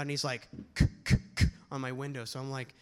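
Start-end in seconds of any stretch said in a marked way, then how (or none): 0.7-1.5 tapping sound. Retold or imitated. Cop tapping on window.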